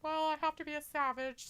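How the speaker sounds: ordinary talk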